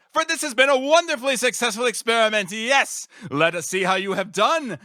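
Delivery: manic glee. Acted. Scientist revealing his betrayal to his subject, manic glee. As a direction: gloat like a mad genius unmasked.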